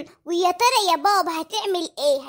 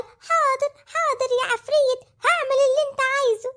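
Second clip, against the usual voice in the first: raised, quivering mock fear; imitating trembling father; 5 semitones higher than usual. Son retells his frightened father answering the ghost, mock trembling. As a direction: play the scared father, voice pitched up. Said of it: "قعد يرتعش وقال".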